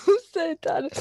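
crying with laughter; laughing. Real, not acted. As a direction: laugh helplessly through it. Cracking up at joke.

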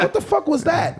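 incredulous retold quote. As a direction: replay the stunned disbelief, voice jumping up. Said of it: "I swear to God, I said".

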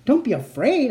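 sarcastic, high echo. A narrator's reading. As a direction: echo it with scorn. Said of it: "I thought".

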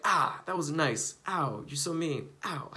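slow, drawn-out playful ows; mock hurt. Insulted passerby feels burned a little, mock hurt. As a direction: whine as if lightly burned. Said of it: "kinda like you just got burned a little bit".

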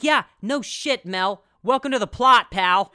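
hammed-up sarcastic exasperation; exasperated sarcasm. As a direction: snap back as if it's painfully obvious. Acts exasperated retort to Mel.

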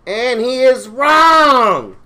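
big, drawn-out announcer verdict; slow, gleeful game-show buzz-off. Pretend. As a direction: stretch the last word out loud and high. Host announces a wrong answer.

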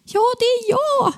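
high, drawn-out answer; overwhelmed cry. Retold or imitated. Patient answering the tearful doctor, overwhelmed high cry.

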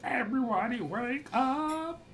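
high, drawn-out call to wake everyone; hammed-up wake-up holler; mock yell. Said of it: "I am gonna yell".

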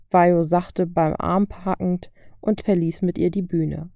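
ordinary delivery, nothing marked

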